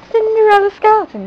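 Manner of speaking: high, quick exclamation of the name; witch exclaiming. A narrator's reading. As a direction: call her name out brightly. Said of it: "the witch exclaimed".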